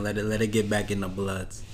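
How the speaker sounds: ordinary talk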